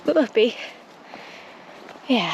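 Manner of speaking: ordinary talk, nothing marked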